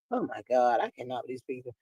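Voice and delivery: low, hushed exasperation; exasperated aside. Real, not acted. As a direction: drop your voice, fed up with them. Gives up after the rant.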